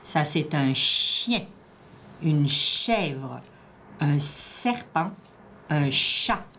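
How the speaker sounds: slow, drawn-out single words; careful word dictation. Shown as a demonstration. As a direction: give each word room, stretching it out. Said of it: "Je te dis les mots".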